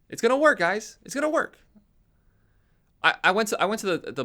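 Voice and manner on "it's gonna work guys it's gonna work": high, loud sing-song reassurance; mock reassurance. Mocking the Bucs front office insisting all is fine, high chipper reassurance. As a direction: pretend everything is fine, overly chipper. Mocking team's forced optimism.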